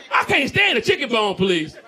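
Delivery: ordinary talk, nothing marked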